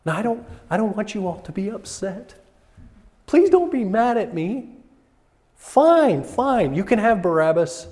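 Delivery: high-pitched pleading, giving way to exasperation; hammed-up placating voice, jumping high on "fine"; appeasing Pilate. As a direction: plead nervously, then burst out giving in. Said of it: "To appease or gain the favor of".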